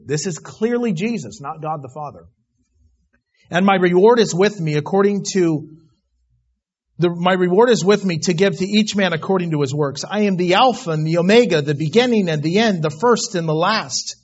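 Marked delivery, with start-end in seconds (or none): 3.5-14.2 loud scripture read-out; preaching. Acted. Preacher reading Jesus's words from Revelation, loud. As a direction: read it as a pulpit proclamation.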